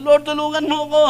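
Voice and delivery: high panicked shout for help; desperate shouting plea. Retold or imitated. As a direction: scream for your life while sliding down. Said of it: "sigaw na sigaw".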